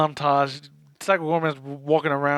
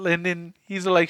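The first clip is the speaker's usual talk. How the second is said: ordinary delivery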